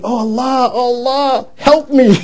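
half-acted desperate wail; retold crying scream. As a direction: wail it like a man on the ground. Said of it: "He's on the ground crying, screaming".

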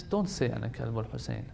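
gentle advice. Retold or imitated. Preacher quoting Ayatollah Bahjat advising the people gently. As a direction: say it softly like a caring elder. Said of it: "He says".

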